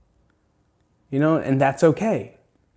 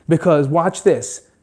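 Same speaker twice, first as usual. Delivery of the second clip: ordinary talk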